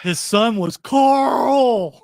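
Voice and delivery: over-enunciated nudge to jog his memory; hint. Real, not acted. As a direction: call out the hint slowly and pointedly. Prompting friend loudly with hint.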